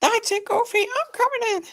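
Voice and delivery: very high put-on voice; cartoon character. Acting out a cartoon scene, very high put-on voice. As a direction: squeal it in a cartoon voice.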